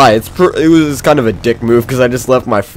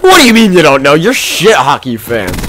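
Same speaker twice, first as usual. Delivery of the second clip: a creepy man's mock outrage; indignant stranger; 3 semitones higher, 4 dB louder than usual. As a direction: scold them like a baffled drunk stranger. Stranger scolding them on bus.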